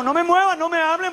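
mock panic. Acting someone barely keeping balance.

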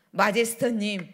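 ordinary talk, nothing marked

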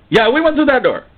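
high, eager confirmation falling at the end; high-pitched assertive reply; defiant answerer. Acted. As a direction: answer back brightly and unapologetically. Acted man answers the church leader.

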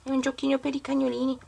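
cute cooing voice. Real, not acted. Cooing over a pet toy.

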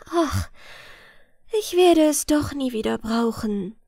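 mild, resigned sigh. A narrator's reading. Girl gives up her smile to the boatmen, lightly sighing. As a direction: sigh out the opening word, then give in. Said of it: "seufzte Blondhärchen".

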